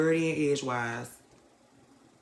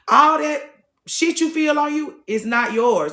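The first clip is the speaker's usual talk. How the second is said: higher, louder and slow; emphatic; 8 semitones higher, 5 dB louder than usual. Real, not acted. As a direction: lean hard on every word. Emphatic preaching point.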